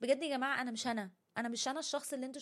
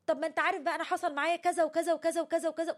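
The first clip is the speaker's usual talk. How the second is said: louder and higher, rattling off your own problems; a friend hijacking the talk, piling it on; self-absorbed rant; 6 semitones higher, 6 dB louder, faster than usual. Retold or imitated. Listener taking over a friend's venting, in a loud rattling mock voice. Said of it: "تقول له".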